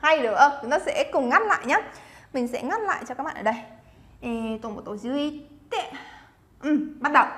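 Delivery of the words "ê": ordinary delivery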